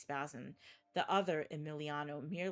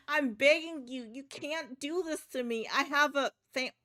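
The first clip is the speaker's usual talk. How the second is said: higher and louder, begging in terror; desperate plea; 9 semitones higher, 7 dB louder than usual. Acted. Emiliano begging through the glass, terrified. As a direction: beg for your life. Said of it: "his words are cut off".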